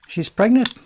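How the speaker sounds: loud, high burst; blurted announcement. Real, not acted. Blurts out reason for losing printer.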